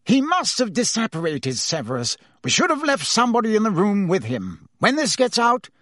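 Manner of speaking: a flustered official fretting; lightly voiced anxious Fudge; worried minister. Fudge worried, pleading with Snape.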